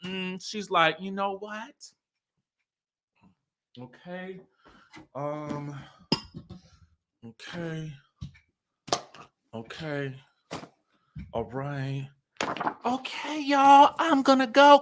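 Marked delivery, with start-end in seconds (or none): 1.0-12.1 very soft, halting mutter with long gaps; imitated restraint. Imitating Melody silently holding back. 12.9-14.8 high, put-on hoarse goodbye; mimicked hoarse voice. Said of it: "she hoarse".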